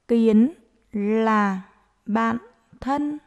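dictating. Shown as a demonstration. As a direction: dictate word by word to young pupils. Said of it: "hãy nghe cô giáo đọc để chúng mình viết".

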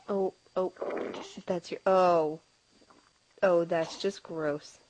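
disgusted surprise. Real, not acted. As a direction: recoil at the smell, half laughing. Baby soils diaper mid-smile.

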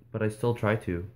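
usual speaking voice

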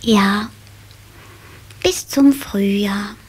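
put-on a drowsy child whispering goodnight; sleepy whisper. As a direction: breathe the words out, half asleep.

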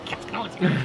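high, playful teasing. Real, not acted. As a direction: sing it out, teasing. Repeats the tease louder.

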